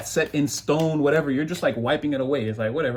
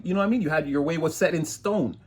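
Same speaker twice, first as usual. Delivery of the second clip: ordinary talk, nothing marked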